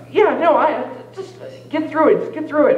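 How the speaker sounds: self pep talk. Retold or imitated. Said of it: "freak out in my head".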